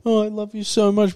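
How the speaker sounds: half-hearted weepy goodbye; mock crying. Passenger calling a loved one before the crash, mock crying. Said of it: "you know, crying".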